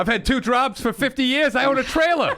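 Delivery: mock driver voice. Impersonating the driver.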